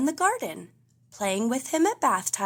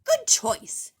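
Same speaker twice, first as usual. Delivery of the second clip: much higher, pleased salesman; shopkeeper voice; 13 semitones higher, 4 dB louder than usual. A narrator's reading. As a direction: flatter the buyer. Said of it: "says Mister Fox".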